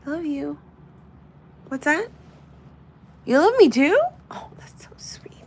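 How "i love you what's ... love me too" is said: much higher, louder cooing; baby talk. Pretend. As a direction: gush at the toy like a pet. Pretend dialogue with figurine.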